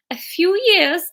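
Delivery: high, unsure Welsh attempt; Welsh accent attempt. Shown as a demonstration. Narrator retries Fiona's line, shaky Welsh accent. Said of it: "What's Welsh?".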